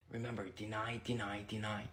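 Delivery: whispered, almost no voice. Acted. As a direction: breathe it under your breath. Whispered reminder to brothers.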